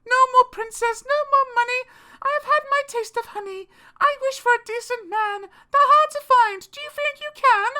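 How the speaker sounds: a high-voiced Cindy making her wish; Cinderella. A narrator's reading. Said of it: "Cindy answered".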